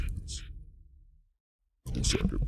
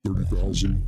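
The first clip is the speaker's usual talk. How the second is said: ordinary delivery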